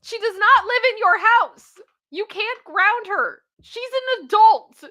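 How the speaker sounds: high, loud exasperated protest; arguing back at the mother in the story; indignant protest. Real, not acted. Reacting to the mother grounding an adult, indignant and loud.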